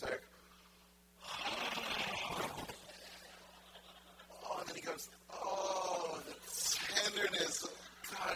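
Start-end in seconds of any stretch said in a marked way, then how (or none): 1.2-4.4 big, long drawn-out sigh; acted sigh of being overcome; overwhelmed sigh. Acting out Moses' overwhelming experience. 5.3-8.3 loud, overcome cry of wonder; acting out being flooded with feeling; overwhelmed awe. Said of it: "he experiences it all through his body".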